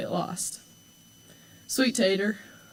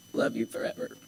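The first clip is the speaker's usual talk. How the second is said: tearful, almost whispered; crying; 7 dB quieter than usual. Real, not acted. Quoting Dad to her sister while crying, whispered. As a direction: let the tears swallow the words. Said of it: "I'm hopefully done crying now".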